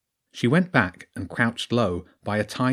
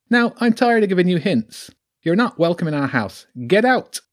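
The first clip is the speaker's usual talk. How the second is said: higher and louder, building to a shouted get out; the weasel loses patience and drives her off; high creature voice rising to a yell; angry order; 9 semitones higher, 6 dB louder than usual. Acted.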